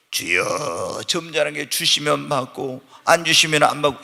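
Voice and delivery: mock the passive believer's prayer; meek polite prayer. Passive believer praying to God, meekly and softly. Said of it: "이게 아니라".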